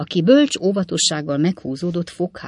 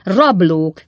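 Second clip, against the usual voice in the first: loud shout near usual pitch; loud, contained indignant cry; cried out; 2 semitones higher, 8 dB louder than usual. A narrator's reading. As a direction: call out the accusation forcefully. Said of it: "kiáltotta Pickwick úr".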